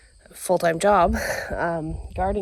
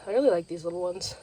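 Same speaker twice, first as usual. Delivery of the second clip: ordinary talk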